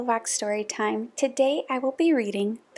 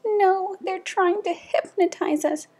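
high, urgent mock panic; alarmed warning; 8 semitones higher than usual. Townsperson warns Teddy puppies are hypnotizing them, alarmed. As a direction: warn the child in storybook alarm.

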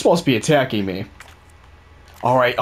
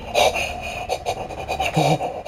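sharp gasp; 6 dB quieter than usual. Real, not acted. Sudden scare.

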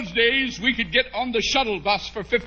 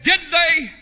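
high, louder startled whisper-question; shocked wife; 7 semitones higher, 4 dB louder than usual. Retold or imitated. Pale wife asks if his pants split, high and startled. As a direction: gasp it out like a horrified wife. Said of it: "But she said".

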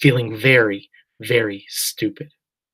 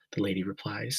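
usual speaking voice

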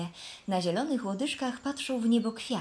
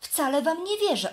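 rising bright protest, a bit louder; cheerful denial; 5 semitones higher, 4 dB louder than usual. Acted. Flax answering the fence posts, cheerful denial. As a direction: laugh off the posts' warning cheerfully. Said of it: "odpowiedział Len wesoło".

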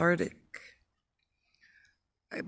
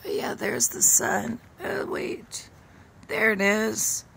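higher, delighted at spotting the sun; light up as the sun appears; pleased surprise; 5 semitones higher than usual. Real, not acted.

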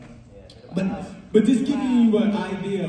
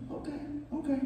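small, high, very soft okay; imitating a tentative, quiet reply; meek agreement; 9 semitones higher, 12 dB quieter, faster than usual. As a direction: agree timidly, high and hushed. Retold wary reply after a warning.

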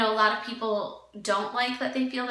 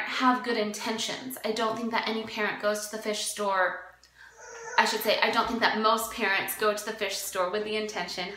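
usual speaking voice

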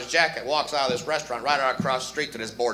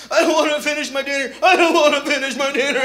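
put-on much higher, whining child; whiny kid voice; 11 semitones higher, 7 dB louder than usual. Child refusing dinner, high whiny voice. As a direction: whine to get out of dinner. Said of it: "Remember that voice as a kid?".

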